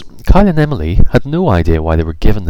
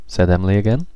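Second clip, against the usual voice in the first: usual speaking voice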